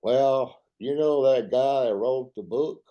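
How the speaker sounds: retold Georgia accent, loud and high; thick Georgia accent. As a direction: put on a Georgia accent and call it out. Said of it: "in his thick Georgia accent".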